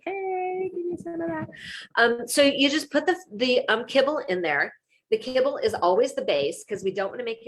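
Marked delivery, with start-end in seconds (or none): 0.0-1.5 high, eager dog voice, much higher; a begging dog talking, squeaky and high; voicing the dog. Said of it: "He's like".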